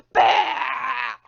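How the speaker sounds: a loud anguished bawl; loud crying wail. Retold or imitated. Harold bawling as his things get dumped, loud cry. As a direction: let out a big wailing cry.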